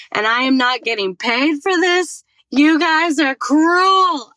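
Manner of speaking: high and loud, slowing into "cruel"; outraged complaint. Retold or imitated. Angry at the unpaid jury duty, loud indignant outburst. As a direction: build to an indignant cry. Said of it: "I was like".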